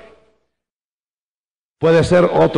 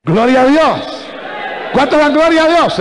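high, ringing pulpit cry; rousing praise call; 9 semitones higher than usual. Real, not acted. Preacher asks congregation for praise, rousing cry. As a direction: call the crowd to give glory.

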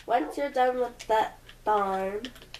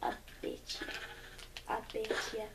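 whispered; 2 semitones lower, 14 dB quieter, faster than usual. Real, not acted. Whispered echoes.

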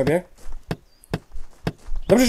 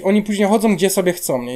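ordinary talk

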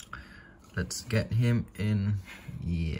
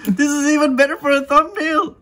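much higher, loud, thrilled shout; practically squealing with excitement; excited; 8 dB louder than usual. Real, not acted. Excited over tarantula pose.

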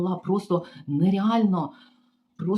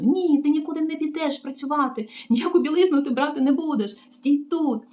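higher, bossy command; mocking the receptionist; 8 semitones higher than usual. Receptionist forbidding the maid to work, high bossy mimicry. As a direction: order her to stay put.

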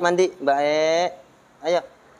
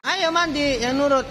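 coaxing, high; 8 semitones higher, slower than usual. Real, not acted. Coaxing man like a child. (membujuk lembut dengan suara tinggi)

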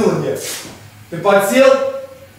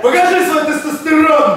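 gym bro; 6 semitones higher than usual. Real, not acted. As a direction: pump up the lifter. Cheering a lifter on. (качковский крик)

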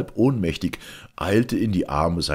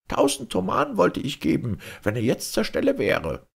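high, lamenting merchant voice; pleading merchant. A narrator's reading. Accused merchant pleading with the Caliph, anxious lament. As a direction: whine your excuses, pitched way up.

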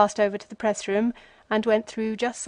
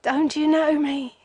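higher, breathless question; gasped; 5 semitones higher than usual. A narrator's reading. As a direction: push it out on a gasp of exhaustion. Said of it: "he gasped".

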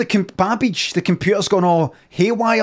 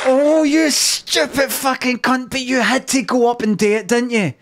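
mock fury; 6 semitones higher than usual. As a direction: berate him theatrically. Mock-furious insult at teammate.

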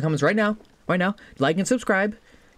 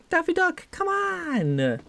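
very high-pitched squeal; high squeal easing down on the last word; excited exclamation; 14 semitones higher, much slower than usual. Real, not acted. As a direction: cry out in playful insistence. Celebrating the chosen nickname.

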